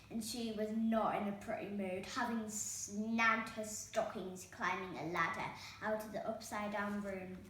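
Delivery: ordinary delivery, nothing marked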